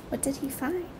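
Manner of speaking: usual speaking voice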